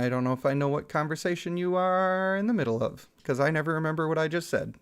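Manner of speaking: normal talking voice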